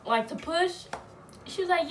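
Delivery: normal talking voice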